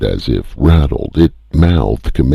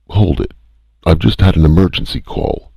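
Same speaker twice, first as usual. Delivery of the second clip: sharp, quick and raised; sharply; 7 semitones higher, faster than usual. A narrator's reading. Calhoun stopping the spaceport voice, sharply and fast. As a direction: cut in sharply. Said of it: "he added sharply".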